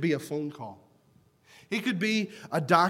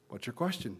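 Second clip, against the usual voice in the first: asked gently and much softer; soft question; 6 semitones lower, 10 dB quieter than usual. Retold or imitated. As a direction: lean down and ask softly. Teacher answers girl softly.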